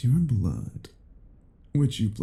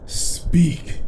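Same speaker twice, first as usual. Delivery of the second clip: sharp, higher "Speak." losing patience; rising demand; 8 semitones higher, 4 dB louder than usual. Acted. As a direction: press harder for the answer. Vampire demands an answer.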